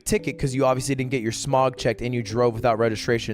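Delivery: low, fast official recital; clerk's rapid rattle. Retold or imitated. DMV clerk explains the ticket process, fast low rattle.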